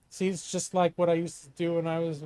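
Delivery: ordinary talk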